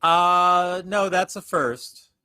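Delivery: "no" drawn out high and slow; high surprised denial. Real, not acted.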